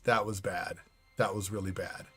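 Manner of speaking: low, fading "that was bad"; hushed dismay. Real, not acted. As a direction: let it sink to a murmur. Repeating shocked reaction.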